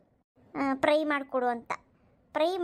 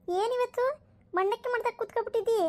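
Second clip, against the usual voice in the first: cheerful call; 10 semitones higher than usual. Acted. Neighbour calls out greeting Jayakka.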